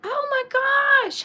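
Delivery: high, delighted oh my gosh; sudden happy surprise; surprised. Real, not acted. Spots viewers in chat.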